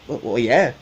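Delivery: normal talking voice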